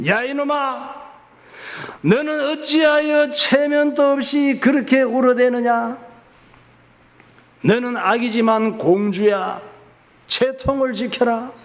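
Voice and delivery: a lightly voiced king telling off his daughter; angry king. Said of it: "화가 나서 소리를 질렀습니다". (우는 딸을 꾸짖는 임금님, 높은 목소리로 호통)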